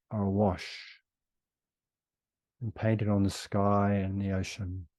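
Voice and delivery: ordinary talk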